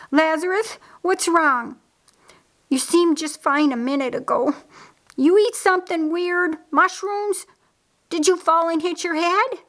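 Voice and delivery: high, urgent worried questions; alarmed questioning. A narrator's reading. Mary runs to her collapsing brother, alarmed. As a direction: question him in rising alarm.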